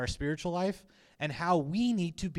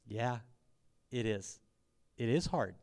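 lower, softer reply with pauses between; understated agreement; 4 semitones lower, 6 dB quieter than usual. Retold or imitated. Preacher answering complaints that it is hard, quiet understated agreement. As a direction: concede it quietly, letting each piece sit.

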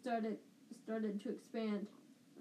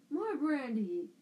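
a loud, slow bark for more brandy; yelled; 3 semitones higher, 6 dB louder, slower than usual. A narrator's reading. Uncle Vernon yelling for more brandy to cut Marge off, loud. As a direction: shout it across the table. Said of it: "yelled Uncle Vernon".

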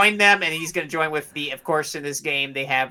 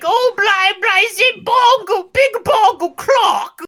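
high, mocking posh British voice, slow at the end; mock British accent; 6 dB louder, slower than usual. Mocking the British in the game, high mock British accent. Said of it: "all they do is like".

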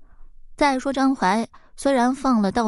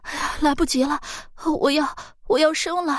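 higher, urgent labour cry; panicked cry; 8 semitones higher than usual. A narrator's reading. Woman in labour tells Zhang Huai it's too late, panicked cry.

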